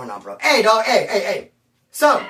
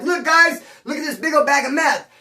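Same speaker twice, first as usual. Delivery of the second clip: loud, much higher excited call; excited vlogger; 8 semitones higher, 3 dB louder than usual. Retold or imitated. Filming for his followers, excited loud call. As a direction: show the viewers his find. Recording video of found meth.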